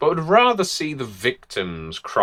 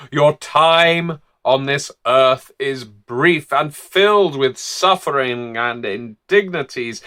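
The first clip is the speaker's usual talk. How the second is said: preacher closing a sermon; 3 semitones higher than usual. Acted. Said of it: "closes his sermons like that".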